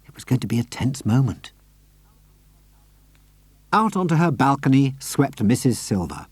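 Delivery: ordinary delivery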